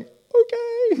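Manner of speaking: mock stunned Mary; shocked okay. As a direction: squeak it out in shock. Mary's shocked reply.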